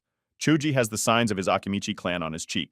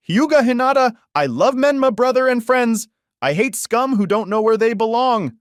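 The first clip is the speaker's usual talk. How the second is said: put-on much higher, louder girlish voice; a high, eager girl's introduction; enthusiastic; 13 semitones higher, 7 dB louder than usual. A narrator's reading. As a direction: gush it eagerly, high and bright. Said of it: "Hyuga said with enthusiasm".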